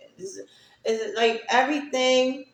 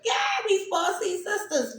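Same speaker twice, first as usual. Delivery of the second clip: happy tears; 10 semitones higher than usual. Real, not acted. Teary retelling.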